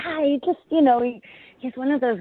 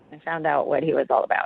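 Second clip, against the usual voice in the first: dryly unimpressed, low; unenthusiastic; 6 semitones lower, faster than usual. Real, not acted. Date telling radio hosts about him, dryly unenthusiastic. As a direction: say it with an obvious lack of enthusiasm. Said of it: "so positive when you say it like that".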